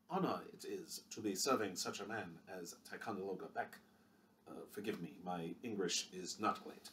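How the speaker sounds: bow and speak it humbly, haltingly; quiet, deferential put-on accent, slowing down; humble foreign physician. Physician Xiao Lin speaks.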